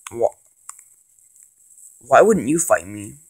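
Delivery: ordinary talk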